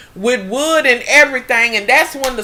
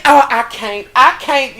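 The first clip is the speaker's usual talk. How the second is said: normal talking voice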